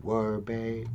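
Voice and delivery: soft, flat two-word reply; mild imitation of a quiet wife. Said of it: "she just was sitting there quiet like".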